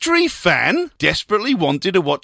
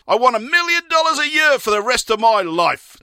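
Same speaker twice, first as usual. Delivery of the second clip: higher, keen husband's request; eager husband; 9 semitones higher than usual. Acted. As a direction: ask like a greedy husband. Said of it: "said the husband".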